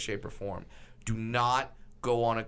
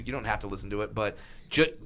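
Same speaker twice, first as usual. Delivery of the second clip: normal talking voice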